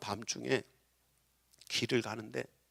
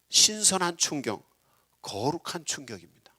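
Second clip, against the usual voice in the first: ordinary talk, nothing marked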